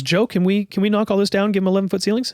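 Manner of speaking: a hint of a breezy request; Judy asking husband. Landlady asking her husband Joe, lightly voiced. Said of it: "She goes".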